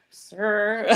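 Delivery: laughing. Real, not acted. Exasperated laugh at instructor.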